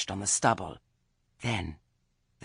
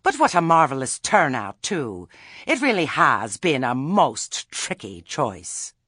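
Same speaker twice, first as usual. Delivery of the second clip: high, loud, gushing delivery; bright; 5 semitones higher, 10 dB louder than usual. A narrator's reading. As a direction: gush brightly over it, loud and lifted. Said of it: "she said brightly".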